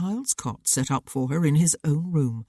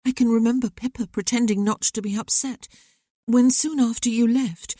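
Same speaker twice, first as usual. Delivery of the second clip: put-on higher young woman, earnest and hurt; young sister's voice; 7 semitones higher than usual. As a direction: let the sister pour out old grievances to her brother. Sister remembers sad losses.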